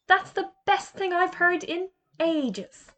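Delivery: high, slow, gloating delight; a boy relishing the bully staying a frog; gleeful boy. Acted. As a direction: savour the good news.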